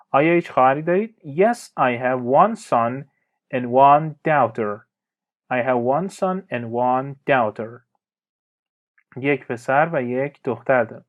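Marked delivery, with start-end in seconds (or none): 1.2-4.9 slow model answer. Shown as a demonstration. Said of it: "teacher models the answer". 5.5-7.8 slow model answer. Shown as a demonstration. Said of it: "teacher repeats the answer".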